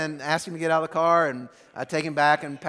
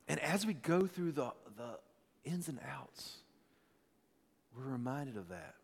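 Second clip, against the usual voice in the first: soft preaching; 2 semitones lower, 8 dB quieter than usual. Acted. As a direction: bring it down and speak gently to the congregation. Pastor turns to intimate preaching.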